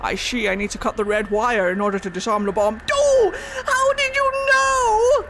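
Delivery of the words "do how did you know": high, loud, slow cartoon outrage; villain caught out, overacted; mock shock.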